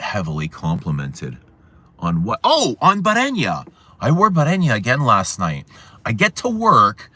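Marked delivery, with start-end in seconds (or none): 2.4-7.1 high, loud and eager to share the story; excited storytelling. Real, not acted. Telling chat about being complimented, excited and loud.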